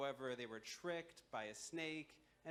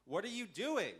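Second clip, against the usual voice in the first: louder, higher startled question; alarmed protest; 8 semitones higher, 8 dB louder than usual. Acted. Man to the snake wrapped round his neck, alarmed. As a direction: jump in pitch, mock-alarmed.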